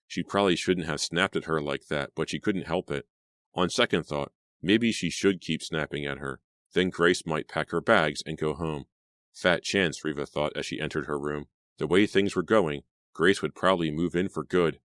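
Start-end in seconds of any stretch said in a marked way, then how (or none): none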